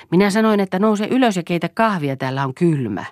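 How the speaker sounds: loud irritated command; angry repeat. Acted. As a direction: snap it at her the second time. Repeats order, angrier, higher.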